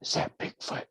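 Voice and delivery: hushed, fully whispered question; imitating wife's whisper. Wife whispers to husband on hearing a howl in the tent, whispered. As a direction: whisper it as if something is outside. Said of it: "and then she whispered".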